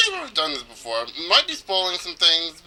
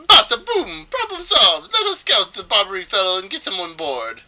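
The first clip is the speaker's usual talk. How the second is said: high, loud, triumphant mock Spanish accent; 5 semitones higher, 5 dB louder than usual. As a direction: exclaim it with a Spanish-accented flourish. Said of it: "I think it's supposed to be Spanish".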